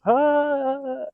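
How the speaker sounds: a high mock whoop, as if you were the drunk man; imitated drunk yell. Retelling a drunk coworker dancing alone, mock high yelp. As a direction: mimic the guy yelling in the lot.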